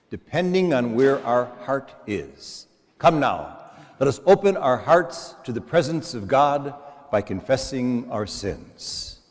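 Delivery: ordinary delivery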